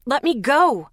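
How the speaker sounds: loud, high snarl through the teeth. Acted. Lexi snarls at Zeke holding her, loud and furious. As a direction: spit it out at him, furious. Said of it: "I snarled through my teeth".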